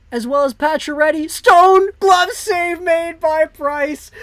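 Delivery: high, loud, stretched-out shout on the save; a real thrilled play-by-play scream; excited goal-line call. Real, not acted. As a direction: yell it like the crowd just jumped. Big save on two-on-one.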